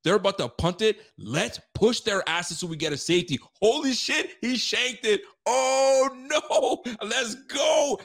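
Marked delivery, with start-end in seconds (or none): 3.6-8.0 excited game reaction. Retold or imitated. Fan reliving a shanked punt, excited. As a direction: cry out in rising excitement.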